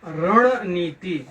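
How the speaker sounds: रणनीति very slowly, रण-नीति; careful pronunciation. Shown as a demonstration. Teacher modelling रणनीति for pupils, slow careful pronunciation. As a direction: stretch रणनीति with a long नी. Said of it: "इसका उच्चारण क्या होगा".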